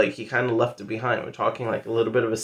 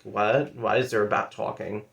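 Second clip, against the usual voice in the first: usual speaking voice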